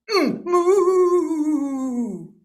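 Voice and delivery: a drawn-out mock moo; playful cow mooing. Imitating the cow.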